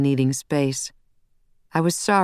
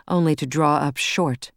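ordinary delivery, nothing marked

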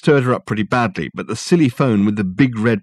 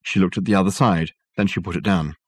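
usual speaking voice

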